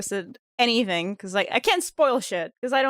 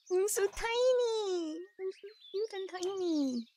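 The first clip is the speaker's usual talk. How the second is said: cooing; 8 semitones higher, 10 dB quieter than usual. Real, not acted. Cooing over cute character.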